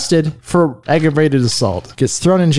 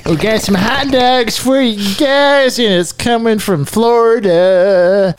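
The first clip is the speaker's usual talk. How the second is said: slow, drawn-out mock radio host; 6 semitones higher, slower than usual. Co-host picks up the radio bit, slow mock DJ. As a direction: lay it on like a DJ teasing a story.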